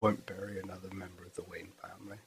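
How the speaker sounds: breathy hushed Alfred line; whispered quote. Pretend. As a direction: murmur it like a secret vow.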